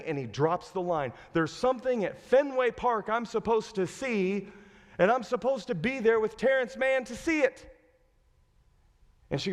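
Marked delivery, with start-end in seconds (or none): 1.3-7.8 high-pitched acted conviction; imitating a husband who must be believed; urgent insistence. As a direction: press the point earnestly, voice raised in pitch. Husband keeps insisting to wife.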